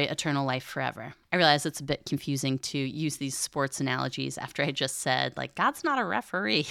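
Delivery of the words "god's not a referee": ordinary talk, nothing marked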